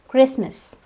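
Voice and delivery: Christmas in two syllables without T; silent T. Shown as a demonstration. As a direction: say Christmas as KRIS-mus. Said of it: "the T is silent".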